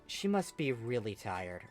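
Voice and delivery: plain reading voice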